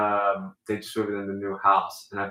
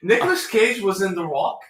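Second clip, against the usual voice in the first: put-on mid-Atlantic accent; 7 semitones higher than usual. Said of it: "mid-Atlantic accent".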